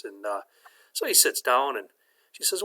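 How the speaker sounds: normal talking voice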